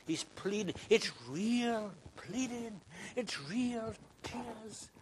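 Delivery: high, very slow, pleading emphasis; slow, high, fervent cry of "real tears"; impassioned preaching. Acted. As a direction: plead with the congregation. Preacher builds to emotional climax.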